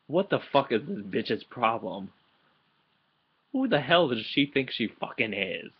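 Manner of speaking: replaying a furious reaction to the chapter, indignant; angry rant at the screen. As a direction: fire it off at the computer. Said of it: "I said this audibly too".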